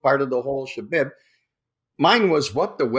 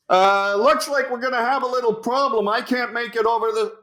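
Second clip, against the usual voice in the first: high, raised voice over rotor noise; pilot shouting over chopper; 9 semitones higher than usual. Retold or imitated. Pilot to Hasselhoff in the chopper, shouting high. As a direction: call out over the engine. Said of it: "Why are you talking so loud?".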